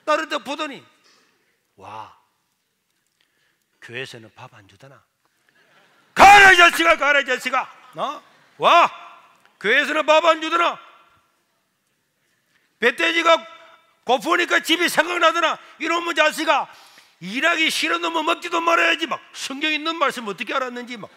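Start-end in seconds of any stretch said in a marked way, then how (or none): 1.7-5.0 low, soft jibe; acted low, quiet taunt; sarcastic mother, quiet. Mother greets son home from church. 6.1-8.2 high, loud scolding outburst; acted furious shout; angry mother shouting. Angry mother drives son away. 8.6-19.1 loud, high-pitched tirade; acted shouting rebuke; scolding mother. Angry mother scolds hungry son.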